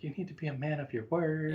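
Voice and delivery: a hint of a stern wife; imitating wife. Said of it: "she's gonna be like".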